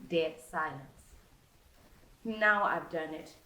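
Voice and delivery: ordinary delivery, nothing marked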